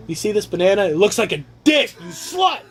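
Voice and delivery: hammed-up strict parent losing it; mock scolding parent. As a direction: pitch jumps up into a shouting tirade.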